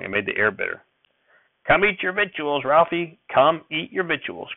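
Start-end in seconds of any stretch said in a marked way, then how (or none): 1.6-3.2 put-on high, sharp snap at the boy; snapped order. A narrator's reading. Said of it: "Grandfather snapped at me". 3.3-4.5 slower, insistent repeat; snapped order. A narrator's reading. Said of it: "Grandfather snapped at me".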